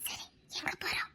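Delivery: soft unvoiced question; hushed whisper. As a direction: whisper the question so nobody hears. Sneaking out together.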